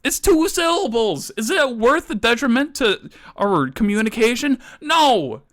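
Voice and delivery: high, heated comic rant; exasperated outburst, voice climbing high; losing temper. Acted. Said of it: "I lost my temper".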